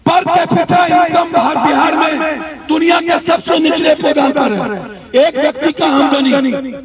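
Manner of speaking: loud public address to the crowd; rally speech. Real, not acted. Politician addressing protesters about poverty in Bihar, loud and forceful. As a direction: project every line to the back of the rally.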